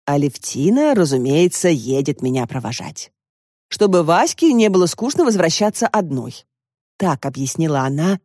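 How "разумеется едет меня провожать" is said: plain reading voice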